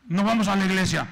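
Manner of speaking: mock husband forbidding church; bossy husband. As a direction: bark it like a controlling husband.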